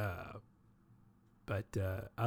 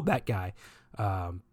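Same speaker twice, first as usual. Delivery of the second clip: ordinary talk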